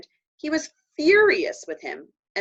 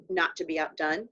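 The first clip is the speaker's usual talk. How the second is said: ordinary delivery, nothing marked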